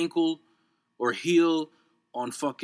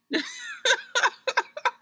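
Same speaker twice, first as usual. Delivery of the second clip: high laugh. Real, not acted. Laughs at own joke.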